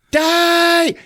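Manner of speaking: high, loud yell; mock villain scream. Gunman about to blow the bomb, high mock scream. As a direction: shriek it.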